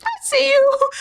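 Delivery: very high, drawn-out mock hesitation; put-on high voice, wheels turning; imitating hesitant woman. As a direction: squeak out a dubious, stretched reaction. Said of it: "Millie is a little bit like".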